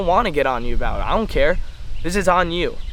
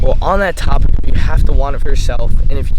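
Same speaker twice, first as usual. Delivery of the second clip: loud, heated rant to the camera; yelling; 8 dB louder than usual. Real, not acted. Teen trader yelling advice at viewers, loud and heated. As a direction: hammer it home at full volume. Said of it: "watch out for myself yelling and cursing".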